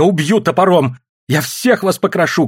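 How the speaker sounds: high-pitched, loud, frightened threat; hysterical shouting. A narrator's reading. Terrified wife threatens everyone from under blanket, hysterical shouting. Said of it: "кричала она, и в страхе".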